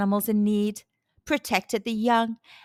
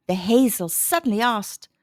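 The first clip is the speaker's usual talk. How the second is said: usual speaking voice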